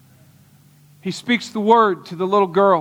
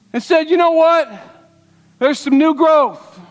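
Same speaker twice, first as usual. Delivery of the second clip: preaching at full force; 8 semitones higher, 4 dB louder than usual. Acted. Preacher telling the congregation about the lilac tree, impassioned. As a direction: build the congregation up, voice raised high.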